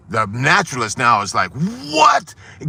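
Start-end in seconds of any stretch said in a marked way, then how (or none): 1.5-2.3 high startled what; shocked what. Acted. Naturalist stunned at the eagle among chickens, startled high cry. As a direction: blurt it out in disbelief.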